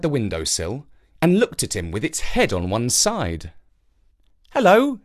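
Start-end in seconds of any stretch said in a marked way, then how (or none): 4.5-5.0 put-on high bright child voice; young boy greeting. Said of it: "said Jake".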